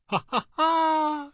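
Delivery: loud, high mocking laughter, slowly; the devil roaring with laughter; shouted laugh. A narrator's reading. The devil laughing loudly at young Brown, a high shouted laugh. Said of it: "shouted he again and again".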